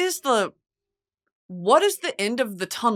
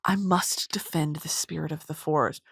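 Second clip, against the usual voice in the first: whispered earnest hero; 5 semitones lower, 6 dB quieter than usual. Retold or imitated. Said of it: "and he's like".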